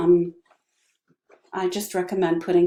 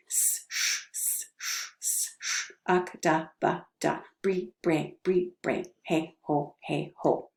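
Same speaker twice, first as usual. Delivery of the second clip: quick drill run; 6 dB quieter, slower than usual. Shown as a demonstration. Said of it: "let's try one, one, one".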